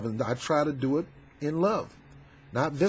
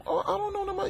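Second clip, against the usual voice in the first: much higher, softer nervous reply; timid backing down; 6 dB quieter, faster than usual. Retold or imitated. The accused guy backing off from the fight, meek and high. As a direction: shrink away, mumbling an excuse.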